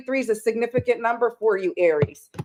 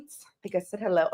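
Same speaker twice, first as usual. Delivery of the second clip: ordinary delivery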